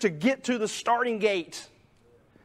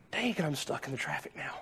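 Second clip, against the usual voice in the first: softer, lower, whispered aside; whispered grumble; 7 semitones lower, 9 dB quieter than usual. Acted. Disciple stuck waiting, whispered grumble. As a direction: grumble it under your breath.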